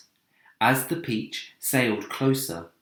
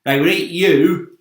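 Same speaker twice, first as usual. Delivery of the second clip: grinning tease; 7 semitones higher, 12 dB louder than usual. Acted. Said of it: "the centipede answered, grinning".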